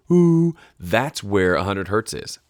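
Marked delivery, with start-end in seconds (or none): 0.1-0.6 hoo, much higher and louder, a real hooting call. Shown as a demonstration. Demoing the hundred hertz hoot to viewers, loud and high. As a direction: belt out the hoo like an owl. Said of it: "if you say the word hoot".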